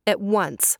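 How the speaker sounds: usual speaking voice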